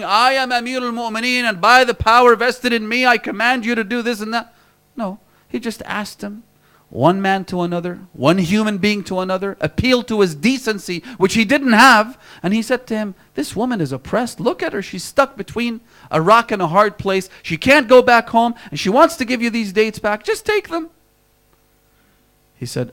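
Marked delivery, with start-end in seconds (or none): 0.0-4.5 mock grand proclamation. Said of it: "didn't go out with guns blazing saying". 13.3-20.9 earnest plea. Retold or imitated. Imam pleads with the seller.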